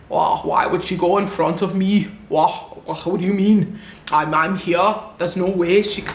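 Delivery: put-on South African accent, affronted; hammed-up indignation in a South African accent; mock indignant South African. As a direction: imitate an entitled South African traveler complaining.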